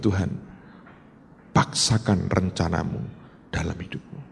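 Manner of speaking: low, soft prayer, fading; humble hushed prayer. Retold or imitated. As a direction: pray it softly and low, broken. First prayer of surrender.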